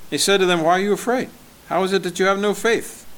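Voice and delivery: scripture reading. Acted. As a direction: read the Gospel out to the congregation.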